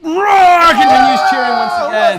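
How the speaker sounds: full-throated roar breaking the hush; sudden crowd roar. Acted. Game master as the crowd erupting, roaring shout. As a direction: explode into a high, loud shout.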